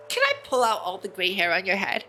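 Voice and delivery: ordinary delivery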